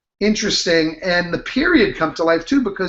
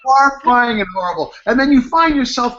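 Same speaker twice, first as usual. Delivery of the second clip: usual speaking voice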